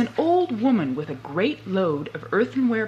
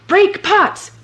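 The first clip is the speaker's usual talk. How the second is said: high, loud magic command; shouted command; 12 semitones higher, 10 dB louder than usual. Acted. Boots commanding the old woman, loud high shout. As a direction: cry it out like a spell.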